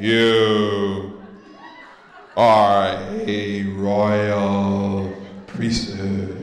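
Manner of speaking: low, slow, drawn-out voice from the dark; a disembodied voice. Pretend. Preacher voicing a hidden voice in the dark, low and slow. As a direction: sound like an unseen voice in a dark room.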